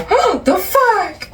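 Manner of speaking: high, slow stunned oh; slow shocked exclaim. Real, not acted. Reacting to the reader's story, high slow shock. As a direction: drag it out in disbelief.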